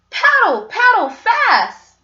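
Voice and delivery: high, loud, urgent cry to paddle; urgent paddling call. Acted. Boy urges his dad to paddle, urgent high call. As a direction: shout them on toward shore.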